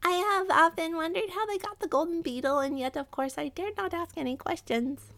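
high rat voice, plaintive; timid rat. Acted. Rat admitting it dared not ask, plaintive.

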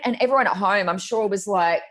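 ordinary talk

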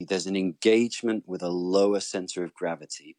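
normal talking voice

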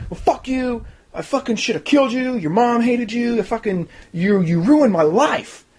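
high-pitched hammed-up father's tirade; mock angry rant. Reviewer parodies Hannah's father cursing her, high mock rage.

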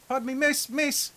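high, exaggerated bad Irish accent; a clumsy stab at an Irish beggar. GM voicing an Irish beggar to the players, bad Irish accent. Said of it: "that's an awful Irish accent".

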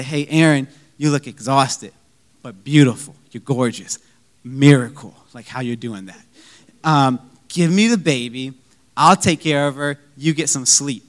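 low, gentle husbandly coaxing; sweet-talk. Retold or imitated. Husband offers to take the baby from his exhausted wife, sweet-talking. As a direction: lay on the tender compliments, low and soft at times.

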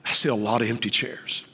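ordinary delivery

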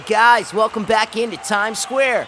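loud, high, hyped-up broadcast announcer; host the Times Square countdown over the crowd; big cheesy live-TV presenter shout; New Year's TV host. Acted. Mock New Year's broadcast hosts.

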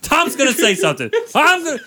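Tom upset. Retold or imitated. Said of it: "talking to himself in third person".

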